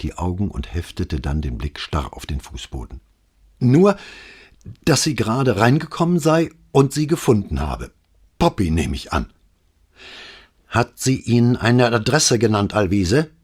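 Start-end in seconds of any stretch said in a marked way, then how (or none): 3.6-9.3 higher, louder, breathless policeman report; rattled young officer reporting to his boss; agitated sergeant. A narrator's reading. Agitated Alvise reporting call. 10.7-13.3 louder, stern repeat; a commissario sternly repeating his question. A narrator's reading. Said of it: "wiederholte Brunetti streng".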